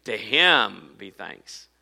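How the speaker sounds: slow, weighty stress on "him"; "To him be thanks", slow, with "him" pressed and rising; emphatic. Shown as a demonstration. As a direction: lean hard on "him" in "To him be thanks". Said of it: "it starts out very emphatically".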